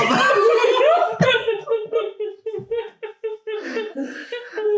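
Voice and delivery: shrieking, breathless laugh; high squealing laugh. Real, not acted. Teller cracks up mid-story.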